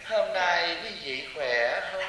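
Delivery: slow, soft, sweet greeting; imitating a gentle monk. Mocking a gentle monk who greets the crowd, slow and sweet. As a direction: coo it softly and slowly like a kindly monk. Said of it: "theo thầy Pháp Hòa".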